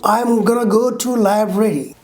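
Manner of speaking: "going to" as "gonna"; reduced form. Shown as a demonstration. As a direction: link "I'm gonna" together. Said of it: "is changed into".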